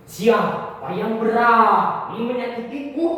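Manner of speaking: high, loud, slow demand; teriak menantang. Acted. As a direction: shout it slowly as an outraged challenge. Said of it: "teriak Dharma". (berteriak lantang dan lambat)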